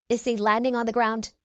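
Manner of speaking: ordinary delivery, nothing marked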